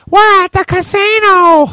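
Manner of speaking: very high, loud mock mom shriek. Son mimics mom announcing the casino, shrieky mom voice. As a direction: holler it high like Mom announcing big news. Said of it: "It's my mom voice".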